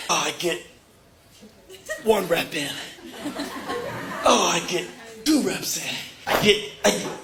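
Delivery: strained mock lifting effort; straining under weight. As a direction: act out pushing through heavy reps, words squeezed out. Acting out straining under a bench press.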